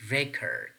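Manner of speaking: "record" stressed on REC; first syllable of "record" stressed. Shown as a demonstration. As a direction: say "record" as a noun, REC-ord. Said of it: "stress pattern on the first syllable".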